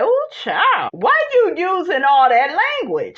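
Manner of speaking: put-on high, shocked ooh child; scandalized. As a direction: clutch your pearls at the cussing. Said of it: "I was like".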